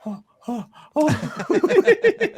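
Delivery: high, breathy imitated "oh"s, breaking into laughter; Sam's little gasps. Fan showing friends how Sam looks on screen, mock gasping. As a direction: mimic small high gasps, tipping into a laugh. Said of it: "he's like this".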